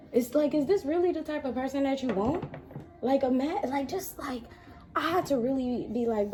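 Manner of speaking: high, blunt talking-to; scolding myself. Retold or imitated. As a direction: tell yourself off like a fed-up friend. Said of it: "asked myself".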